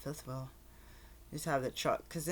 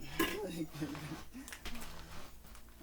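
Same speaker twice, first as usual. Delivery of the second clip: soft laugh; 5 dB quieter than usual. Real, not acted. Laughs at own accent.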